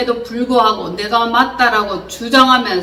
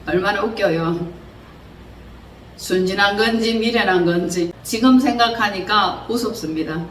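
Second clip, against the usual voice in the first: normal talking voice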